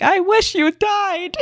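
high, slow hammed-up disappointed Adam; mock Adam. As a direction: deliver it slow and high as a joking boyfriend. Adam voiced disappointed, joke.

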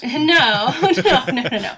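high, loud laughing no-no-no; laughing denial. Real, not acted. Guest teased about sharing a secret, laughing denial. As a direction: protest through real laughter.

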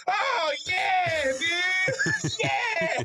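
very high shouted hype-man yells; losing his shit. Retold or imitated. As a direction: imitate a fan screaming in delight. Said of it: "this guy lost his fucking shit".

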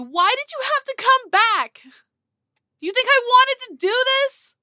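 furious high-pitched scream; loud, high, anguished yell. Acted. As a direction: scream it down the road after them. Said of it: "she just screams at you".

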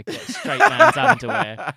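cracking up at her own joke; laughing. Real, not acted. Teasing her friend about a lying scavenger hunt, laughing.

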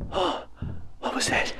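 hushed, unvoiced and wary; nervous whisper. Hears someone in house.

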